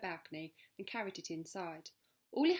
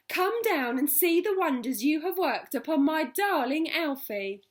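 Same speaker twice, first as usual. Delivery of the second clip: high, loud, thrilled calling; overjoyed; 8 semitones higher, 12 dB louder than usual. Acted. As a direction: shout the good news upward. Mrs Silver calling up, thrilled.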